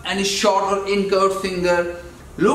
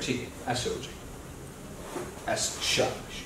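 whispering; 6 semitones lower, 12 dB quieter, faster than usual. Real, not acted. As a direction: gently whisper the praise and the request. Settling the child on the couch.

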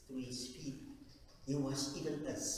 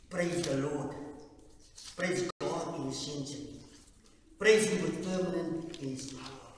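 loud proclaimed psalm reading; psalm read aloud; 2 semitones higher, 9 dB louder than usual. Acted. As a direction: declare the psalm to the congregation. Reads psalm loudly.

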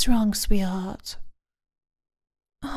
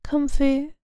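normal talking voice

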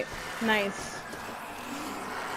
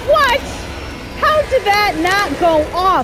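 raging gamer; 5 semitones higher than usual. Real, not acted. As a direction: yell at the screen in fury. Gamer raging at a failed trap.

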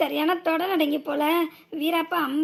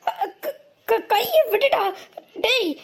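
pained cry; 6 semitones higher, slower than usual. Acted. Man's arm twisted in fight.

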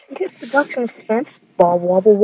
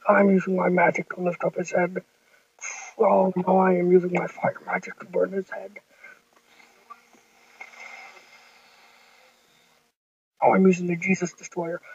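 lower, fast, bossy kid's bad-guy voice; Jesus villain voice; 7 semitones lower, 3 dB quieter than usual. Jesus threatening Bob Wobble Wobble before firing his beam. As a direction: growl it down low like a toy villain.

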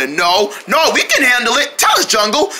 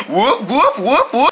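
normal talking voice

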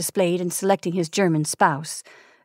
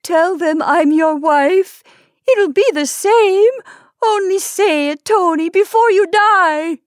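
much higher, louder shriek; shrieking plea; 10 semitones higher, 7 dB louder than usual. Acted. Wife begging her dying husband to call her his wife, shrieking. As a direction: rise into a desperate shriek. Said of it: "her voice rising to a shriek".

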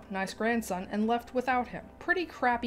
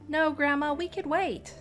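nice grandson; 6 semitones higher than usual. Acted. Grandson voiced high.

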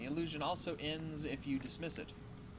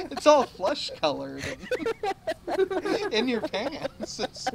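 laughing; 9 semitones higher, 11 dB louder, slower than usual. Real, not acted. Laughing at a dirty joke.

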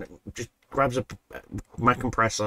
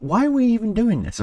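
incredulous question; 14 semitones higher, 3 dB louder than usual. Retold or imitated. Asking Dennis why they bother, high and incredulous. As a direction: pitch jumps high in disbelief. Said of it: "I said".

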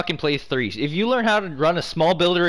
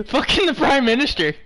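laughing; 9 semitones higher than usual. Real, not acted. Laughing at the video.

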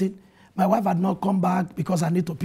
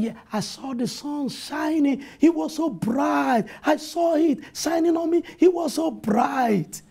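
put-on higher, slow, awed child retelling a vision; young son's voice; 8 semitones higher, slower than usual. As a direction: speak slowly like a wide-eyed little boy. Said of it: "He said".

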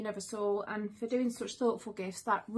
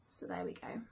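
barely breathed whisper; hushed; 4 semitones lower, 11 dB quieter than usual. Real, not acted. Mother looking at her son's photo, whispering softly. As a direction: let the words fall to a soft whisper.

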